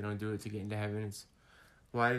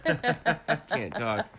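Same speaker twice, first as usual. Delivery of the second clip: laughing; 6 semitones higher, 7 dB louder, much slower than usual. Real, not acted. Laughing at own numb slurring.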